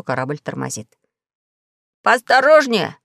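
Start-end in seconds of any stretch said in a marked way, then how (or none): 2.0-3.0 higher, louder sharp rebuke; snapping shout. A narrator's reading. Pashka rebuking the braking ship, loud snap. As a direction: bark the warning. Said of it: "прикрикнул на Гайдо Пашка".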